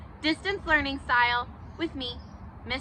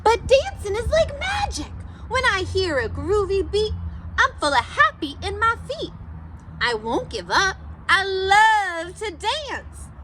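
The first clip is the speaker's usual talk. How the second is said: put-on a cool cat cheering himself on, big and bright for the kids; silly Pete the Cat voice; 5 semitones higher than usual. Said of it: "my silly Pete the Cat voice".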